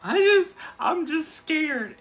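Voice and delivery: mock wail, high and loud; high, drawn-out mock bawling; crying, freaking out. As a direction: imitate a sobbing, scared kid at full pitch. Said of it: "freaking out, like, crying super hard".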